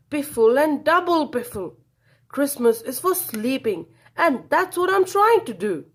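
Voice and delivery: loud, high furious refusal; angry shout. A narrator's reading. Badger slams the door on mole, shouting. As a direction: shout him off the doorstep.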